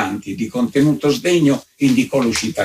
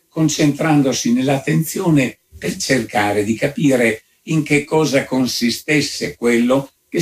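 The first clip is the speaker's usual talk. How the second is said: ordinary delivery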